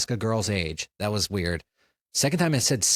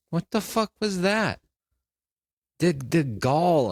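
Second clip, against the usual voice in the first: high, stunned outburst; can't believe what just happened; shocked disbelief; 8 semitones higher than usual. Real, not acted. Host reacts after guest leaves.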